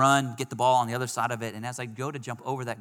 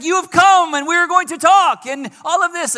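cheery foreign greeting; 16 dB louder than usual. Retold or imitated. Tomas greets him at door.

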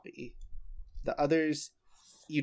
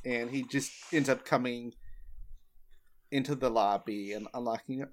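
ordinary talk

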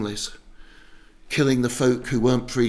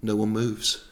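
usual speaking voice

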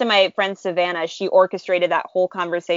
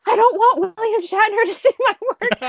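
high-pitched, hammed-up panicky wail; terrified. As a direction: squeal it in comic terror. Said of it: "it was, it was terrifying".

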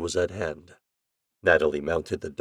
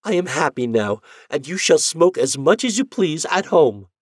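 high, loud happy relief; wife reconciled with her husband; relieved cry; 9 semitones higher, 7 dB louder than usual. Acted. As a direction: cry it out joyfully.

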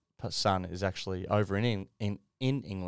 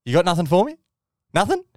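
teasing prod; 9 semitones higher, 9 dB louder, faster than usual. Retold or imitated. Teasing Tim for praise.

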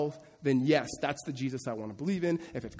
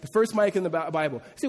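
usual speaking voice

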